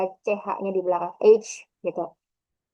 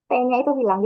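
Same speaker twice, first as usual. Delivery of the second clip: normal talking voice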